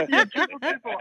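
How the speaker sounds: laughing while repeating it. Real, not acted. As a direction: chuckle through the words. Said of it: "I love it".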